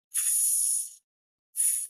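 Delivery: voiceless "تس", consonants only; isolated TS sound. Shown as a demonstration. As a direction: release "تس" softly with no vowel. ("تس" من غير صوت)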